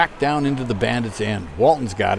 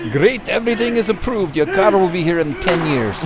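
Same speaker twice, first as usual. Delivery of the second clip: higher, cheery official voice; mock Soviet car clerk; 5 semitones higher than usual. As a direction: play the clerk brightly pitched up. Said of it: "and he goes".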